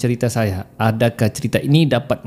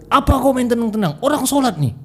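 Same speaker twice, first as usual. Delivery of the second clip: high-pitched indignant telling-off; worked-up, high-voiced complaint; acted scolding; 10 semitones higher than usual. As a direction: scold the unseen prankster in a high voice. Scared worshipper scolds the jinn.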